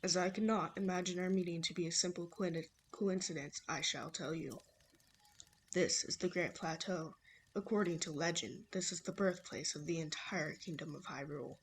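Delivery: low-voiced old man; man imitation. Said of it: "my horrible man imitation".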